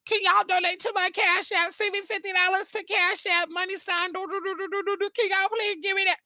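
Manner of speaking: high whiny mock begging voice; a fake-sad YouTuber pleading for Cash App money. Vlogger mocks a YouTuber begging viewers for money, high put-on whine. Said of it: "Mama would get up on this YouTube and be like".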